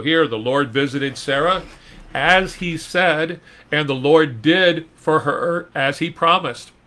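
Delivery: preacher lifting the promise; emphatic preaching. Acted. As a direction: proclaim it to the room. Preaching the verse.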